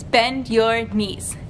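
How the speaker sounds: usual speaking voice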